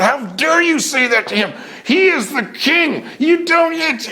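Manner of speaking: hammed-up angry defense of the king; mock indignant outburst. Kid defending the king on stage.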